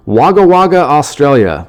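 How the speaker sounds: loud, high mock Australian accent. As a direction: put on a big Aussie accent. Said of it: "in Australia with that accent".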